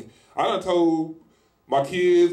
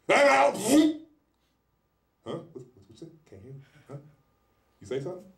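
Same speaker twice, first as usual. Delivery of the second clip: mock not hearing; faster than usual. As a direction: play deaf to a rude request, dismissive. Mocking someone who comes stupid.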